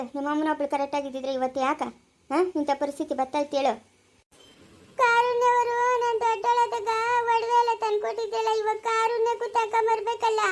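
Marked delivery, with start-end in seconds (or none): 5.0-10.4 put-on high, childlike complaining voice; little granddaughter. Granddaughter telling grandma her grandpa stays away, childlike whine.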